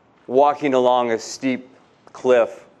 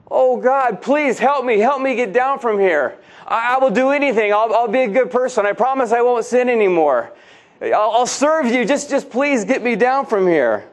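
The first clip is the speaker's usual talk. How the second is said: high, fast, panicky begging; desperate pleading; 7 semitones higher than usual. Acted. Jack hanging from a branch begs God to save him, high and panicked. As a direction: beg for your life, tripping over the words.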